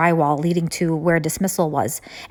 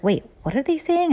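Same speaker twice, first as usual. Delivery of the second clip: startled realization; 9 semitones higher than usual. Retold or imitated. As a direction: catch yourself as it dawns on you. Sudden realization during real lockdown.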